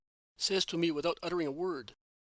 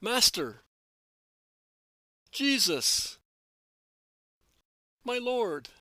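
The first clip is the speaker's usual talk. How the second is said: much louder, higher calls full of joy; joyful cries; 6 semitones higher, 7 dB louder, much slower than usual. A narrator's reading. Disciples greet their master from afar with joyful cries. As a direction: call out to him in reverent joy. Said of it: "The three cries of the disciple".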